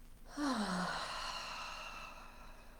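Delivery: slow, breathy sigh trailing away; long soft exhale. Shown as a demonstration. Leader calling back new energy, long soft exhale.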